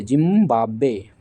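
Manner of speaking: जिम्बाब्वे stretched out slowly, syllable by syllable; careful pronunciation. Shown as a demonstration. Teacher showing students how to pronounce जिम्बाब्वे, slow and drawn out. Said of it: "इसका उच्चारण सही कीजिएगा".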